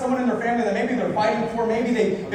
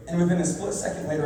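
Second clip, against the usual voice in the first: ordinary talk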